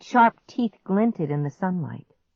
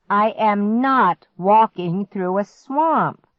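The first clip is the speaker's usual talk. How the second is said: put-on slow, loud, firm refusal from the boy; firm declaration; 5 semitones higher, 6 dB louder, slower than usual. A narrator's reading. Clark refusing his sister's swamp walk, firmly. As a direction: put your foot down, slowly. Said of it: "Clark declared".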